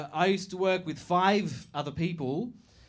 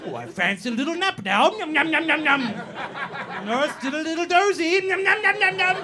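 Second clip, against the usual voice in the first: high, louder cutesy nom nom voice; sing-song sleepy nursery voice, much higher; mock baby talk; 9 semitones higher, 5 dB louder than usual. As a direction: coo it like talking to a toddler. Mocking advice about napping.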